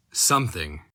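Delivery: plain reading voice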